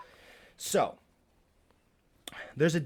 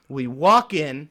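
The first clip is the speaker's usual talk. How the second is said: loud, drawn-out New York swagger; New York macho; 6 dB louder, slower than usual. Pretend. Comic telling his story with macho swagger, loud New York delivery. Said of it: "like, a little New York with it".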